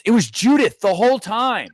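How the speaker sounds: high, loud mock astonishment; mock shock reveal. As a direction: blurt out the big twist, hammed-up. Acts out mock shock twist reveal.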